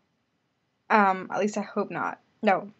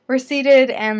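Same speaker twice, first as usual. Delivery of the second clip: ordinary talk, nothing marked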